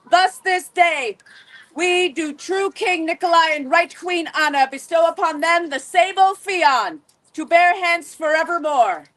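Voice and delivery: ordinary talk, nothing marked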